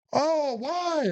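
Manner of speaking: high, wounded 'why' in imitation; retold dismayed protest. Korean soldier answering the threat, mock dismay.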